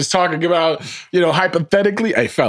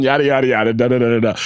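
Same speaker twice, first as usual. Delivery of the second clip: normal talking voice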